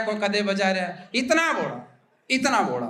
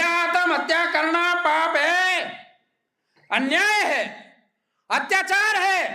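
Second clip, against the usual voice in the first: mock speech; 7 semitones higher, slower than usual. Leader giving a speech.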